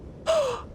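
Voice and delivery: a high startled intake of breath; sharp gasp. Real, not acted. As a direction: gasp as if something jumped out. Sudden shock in dark room.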